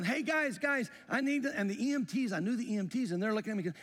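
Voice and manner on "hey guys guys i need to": raised, pitched-up call. Retold or imitated. Husband calling to the EMTs at the ambulance, urgent shout. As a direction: call urgently across the scene. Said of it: "I'm yelling".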